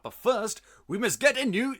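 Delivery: ordinary talk, nothing marked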